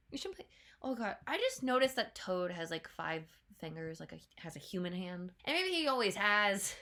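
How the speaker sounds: ordinary talk, nothing marked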